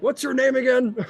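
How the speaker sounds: high-pitched, eager question; excited asking. Acted. Onthar asks the winner's name, excited and high. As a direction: ask mid-celebration, voice up.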